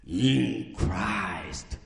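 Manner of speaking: ordinary talk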